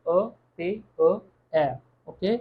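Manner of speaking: ordinary talk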